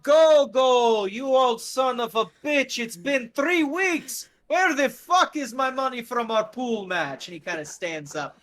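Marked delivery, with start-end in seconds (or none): 0.0-7.3 put-on big booming high yell at an old friend, slow at first; rowdy shouting greeting. The Mac yelling at Gogol about pool money, loud and rowdy. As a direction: bellow it like a rough old buddy. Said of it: "he goes".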